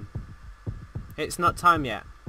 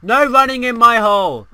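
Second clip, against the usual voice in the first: loud, higher-pitched telling-off; mock scolding shout; 4 semitones higher, 10 dB louder than usual. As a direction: shout it like a hall monitor. Mock scolding during play.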